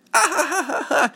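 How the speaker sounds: high, loud teasing cackle; mocking laugh. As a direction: burst into a jeering boy's laugh. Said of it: "they would laugh at him".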